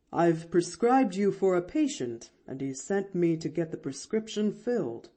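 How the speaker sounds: lightly voiced doctor; announcing doctor. A narrator's reading. Doctor giving the girl his errand, announcing. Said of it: "announced the doctor".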